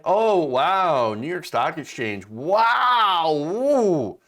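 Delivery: sarcastic wow, ooh; mock-impressed gushing; mock awe. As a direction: fake being impressed. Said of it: "I don't give a rip".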